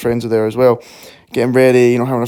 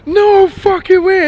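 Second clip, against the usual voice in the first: much higher, loud and slow; stunned disbelief; 5 dB louder, slower than usual. Retold or imitated. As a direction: squeal it out in disbelief. Stunned reaction to fan.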